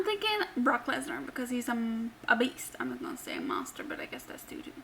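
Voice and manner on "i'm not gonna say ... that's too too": very soft, low, fast aside; muttered aside. Real, not acted. Host second-guessing calling the wrestler a monster, muttered aside. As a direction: drop almost to a whisper. Hushed self-correcting aside.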